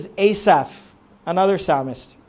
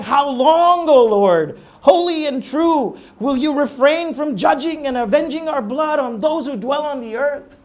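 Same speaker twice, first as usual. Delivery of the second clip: loud, high desperate cry to God; 8 semitones higher, 7 dB louder than usual. Retold or imitated. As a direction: cry it out to heaven in anguish. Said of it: "And they're crying".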